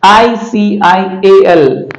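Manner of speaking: slow careful spelling; teacher spelling it out; spelled slowly. Shown as a demonstration. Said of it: "इसको पढ़ा जाता है".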